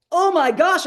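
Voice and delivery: high, loud acted panic; mock panicked outburst; sudden anxiety. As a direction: re-enact a jolt of alarm. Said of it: "I hit anxiety".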